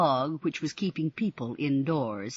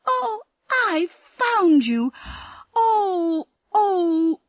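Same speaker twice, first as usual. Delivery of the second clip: high, slow, tearful sobbing; relieved crying; 7 dB louder, much slower than usual. Acted. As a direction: weep with relief at finding her. Sandy weeps with relief.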